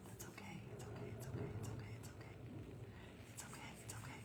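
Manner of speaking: soft repeated whisper to herself; calm yourself down under your breath; hushed self-soothing murmur; whispered reassurance. Real, not acted. Soothing herself after a painting slip.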